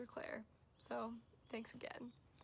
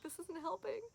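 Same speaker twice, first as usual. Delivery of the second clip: exasperated quote; 7 semitones higher than usual. Retold or imitated. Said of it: "So I was like".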